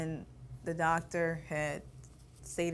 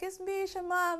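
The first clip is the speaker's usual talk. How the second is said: higher, gentle motherly call; mother at the door; 14 semitones higher than usual. Retold or imitated. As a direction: reassure the sick child through the door.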